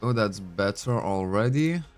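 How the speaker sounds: ordinary talk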